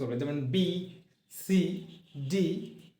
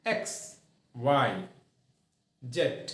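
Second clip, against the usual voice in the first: ordinary talk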